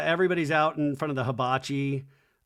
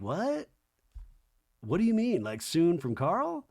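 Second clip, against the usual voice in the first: shocked question; 4 semitones higher than usual. Retold or imitated. Lindsay shocked.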